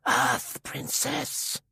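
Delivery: slow accusing hiss of a huge warrior. A narrator's reading. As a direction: drag the words out in a menacing hiss. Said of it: "Sorg whispered accusingly".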